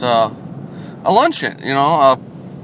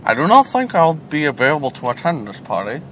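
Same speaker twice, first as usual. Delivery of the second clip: Bane voice; 5 dB quieter than usual. Acted. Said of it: "that voice kinda reminds me of".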